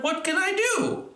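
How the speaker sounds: put-on old man asking for help; high anxious old man. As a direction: beg the wise man for help. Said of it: "Peter said".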